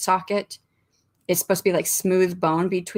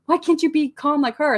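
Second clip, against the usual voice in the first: exasperated; 9 semitones higher, 4 dB louder than usual. Retold or imitated. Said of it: "I'm like".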